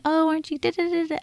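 put-on high baby-talk coo; high-pitched cooing. Shown as a demonstration. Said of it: "we're cooing in high-pitched tones".

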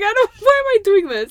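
high, loud exasperated wail; mock despair. Telling a friend about dating-app weirdos, wailing in mock despair. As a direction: wail the question in dramatic despair.

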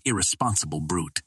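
plain reading voice

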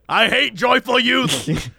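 slow, put-on old-timer grumble; hammed-up old codger rant; cranky old man. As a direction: declare it like a crotchety old man. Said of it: "Krender and I are official old men".

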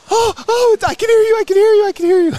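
squealed happy relief; imitating relieved wife.

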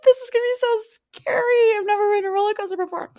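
hammed-up fear, fast on the last line; mock scared Kim. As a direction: play a giddy, pretend-terrified girl clutching her date on the coaster. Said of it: "you're scared".

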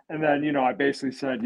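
usual speaking voice

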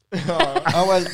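ordinary delivery

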